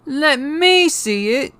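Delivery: put-on very high, loud, slow boy; shrieked demand. A narrator's reading. Son demanding to see the letter, high loud demand. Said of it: "demanded Dudley".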